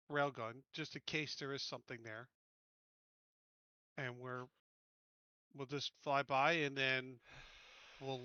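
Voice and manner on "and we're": ordinary talk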